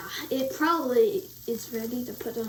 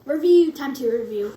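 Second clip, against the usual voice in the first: announcing; 2 semitones lower, 8 dB louder than usual. Acted. Calling out segment start.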